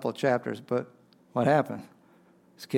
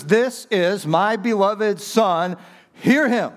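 slow, higher, louder proclamation from the cloud; voice of God; 6 semitones higher, 3 dB louder, slower than usual. Retold or imitated. God speaking to the three disciples, slow ringing proclamation. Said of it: "And he says".

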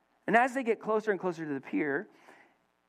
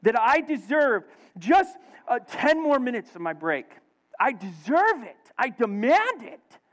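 entitled demand; 6 semitones higher, 4 dB louder than usual. Acted. Employee claiming more break time, mock entitled. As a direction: draw out each demand, hammed up.

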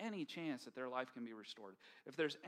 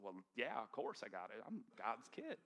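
smug entitlement; 4 semitones lower, 5 dB quieter than usual. Retold or imitated. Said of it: "I just thought".

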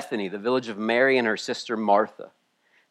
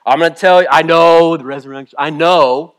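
loud, higher, insistent comeback; exasperated insistence on knowing; heady, combative retort; 3 semitones higher, 8 dB louder than usual. Acted. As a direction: talk back forcefully, as a know-it-all. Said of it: "So she gets heady with him".

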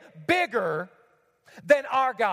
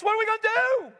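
lightly voiced fretting God, a bit higher; hand-wringing worry, lightly mocked; mock panicked God; 8 semitones higher than usual. Preacher mocking a fretting God pacing heaven, light worry. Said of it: "surely God is in heaven just like you, wringing his hands".

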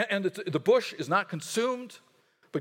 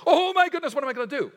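much higher and louder, panicked squeal; mock panic; 8 semitones higher, 6 dB louder, faster than usual. Preacher acts out a believer's sudden fear, high panicked cry. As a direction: play the sudden panic big. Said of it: "there's this panic in our hearts".

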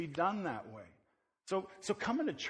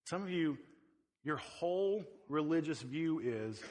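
ordinary talk, nothing marked

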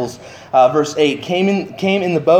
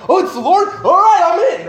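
all-in Peter; 12 semitones higher than usual. Retold or imitated. Peter recognizing Jesus and diving in, excited shout. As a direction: jump in without a second thought. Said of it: "full on going after Jesus".

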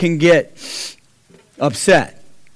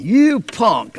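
retold angry shout; 4 semitones higher than usual. Landlord yelling at the boyfriend over the phone, shouting. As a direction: spit the insult at him. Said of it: "that's when I went".

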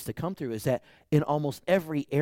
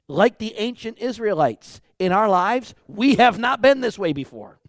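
higher, louder preaching; 7 semitones higher, 8 dB louder than usual. Acted. Pastor rouses his congregation, loud and high. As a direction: lift it up and proclaim.

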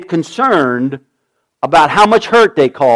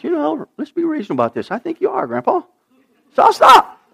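mock reasonable toddler; 10 semitones higher, faster than usual.

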